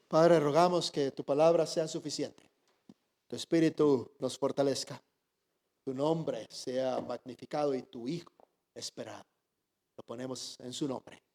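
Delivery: earnest closing prayer, starting strong, fading to a hush. Acted. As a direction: pastor leading the congregation in prayer.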